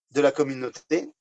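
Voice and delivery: ordinary delivery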